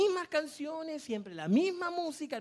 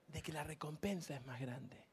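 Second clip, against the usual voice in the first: hushed whisper of a secret reward; 7 semitones lower, 12 dB quieter than usual. Acted. Pastor whispering the reward to the congregation, hushed. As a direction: breathe it out, barely voiced.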